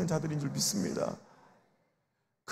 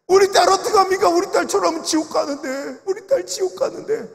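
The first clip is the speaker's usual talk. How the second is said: much higher, louder cry of despair; retold despairing cry of an old father; 9 semitones higher, 8 dB louder than usual. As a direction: wail for a daughter lost to hell. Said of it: "father's lament retold".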